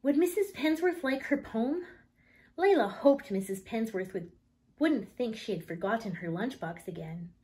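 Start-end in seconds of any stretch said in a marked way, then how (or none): none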